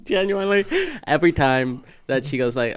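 ordinary talk, nothing marked

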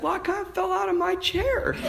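imitated high, sweet little voice; sweet and joyful. Fallen man explaining himself to the priest, sweetly cheerful. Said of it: "not just joyful".

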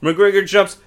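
normal talking voice

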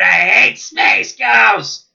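high, loud, slow cartoon shriek; Zorak outburst. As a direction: snap from deadpan into a yell. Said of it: "And Zorak goes".

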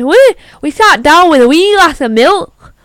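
put-on a Scottish accent slips into the line; accidental Scottish accent. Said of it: "I sounded Scottish there".

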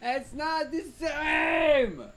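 high, slow, drawn-out wail; anguished cry. Real, not acted. Friend wailing in exasperation over the word, high and slow. Heated argument about pronunciation.

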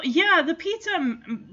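ordinary talk

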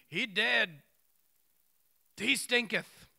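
a playful mock-old-timey voice; mock folksy Mary. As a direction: ham up the blunt sister.

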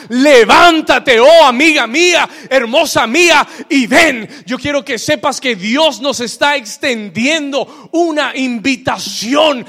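loud, high proclamation to the church; full-force preaching. Real, not acted. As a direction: call the verse out over the congregation. (predicación enérgica a toda voz)

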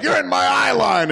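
light imitation of someone yelling; imitated yelling. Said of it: "gonna start yelling like".

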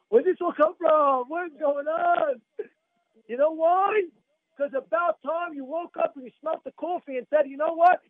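high, loud, exclaiming sermon voice; impassioned preaching. Acted. Rabbi telling listeners to wake up, impassioned preaching. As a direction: exhort them at high pitch.

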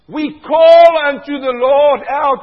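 loud, slow cry to the Lord; preacher shouting to demonstrate calling out. Shown as a demonstration. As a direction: call it out with full force. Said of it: "that means to call out".

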